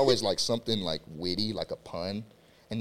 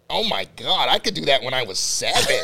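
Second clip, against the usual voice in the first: a lightly voiced mocking coach, a bit louder; lightly voiced coach mocking a weak lift; coach impression; 4 semitones higher, 8 dB louder than usual. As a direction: scoff like a coach unimpressed by the lift. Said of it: "who is this?".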